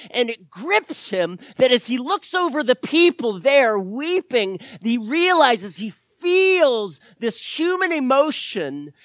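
loud, rising preacher's build; fervent preaching. Acted. Pastor stirring the congregation, loud fervent preaching. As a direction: lift the church with fervor.

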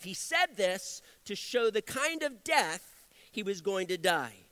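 normal talking voice

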